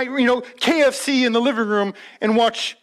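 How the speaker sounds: ordinary delivery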